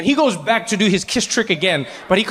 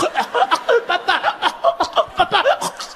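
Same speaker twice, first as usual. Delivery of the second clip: high-pitched choked gurgles and gasps; choking child; 11 semitones higher than usual. Retold or imitated. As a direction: gag as if being strangled, hammed-up. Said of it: "little Jean-Pierre is choking".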